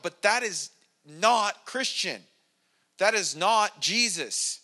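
preacher's measured, emphatic NOT; emphatic refutation. Real, not acted.

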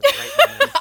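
high, loud mocking laugh through the words. Mocking the actor's bragging.

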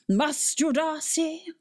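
high, slow, imperious call; haughty regal voice. Acted. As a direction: summon the cat like a queen. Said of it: "a haughty, regal voice".

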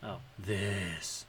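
dropping voice deep. Pretend. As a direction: drop into a low, soft put-on voice. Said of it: "Now I talk in a deeper voice".

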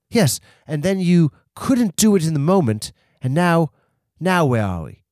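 put-on British accent. [name] faults the host for stalling, British accent. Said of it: "I have this British accent".

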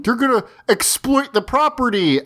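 high, whiny imitation of a fan; mocking fan voice. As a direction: mimic a panicky fan. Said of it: "I shouldn't have used that voice".

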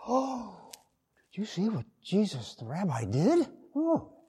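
high, hushed shocked exclaim; shocked bystander. Retold or imitated. Preacher voices bearers shocked that the rabbi touched the bier, high and hushed. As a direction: gasp out the scandal in disbelief. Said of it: "And they were shocked".

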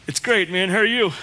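bright put-on churchy hello, rising high; fake cheerful greeting. Churchgoer at the door hiding his troubles, fake cheerful hello. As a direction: play the smiling everything-is-fine churchgoer.

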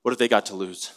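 ordinary talk, nothing marked

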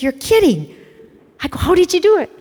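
a stunned, excited you're kidding; astonished. Retold or imitated. The speaker, stunned that Father got through, exclaiming in surprise.

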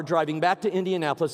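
normal talking voice

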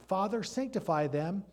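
slow, rising solemn quotation; quoting Jesus. Retold or imitated. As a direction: let each word land. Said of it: "Jesus said".

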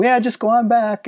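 breezy, no fuss, just go on back; easygoing receptionist. Retold or imitated. Receptionist waves Alan through to the back, casual and high. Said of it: "And she'd go".

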